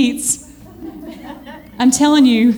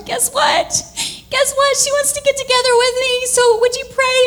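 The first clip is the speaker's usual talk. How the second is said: excited call; 12 semitones higher than usual. Retold or imitated.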